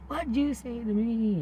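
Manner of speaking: high, loud and slow retort; angry challenge. Retold or imitated. Mary snapping back at the demon, slow and loud. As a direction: square up to the insult. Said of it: "and she says".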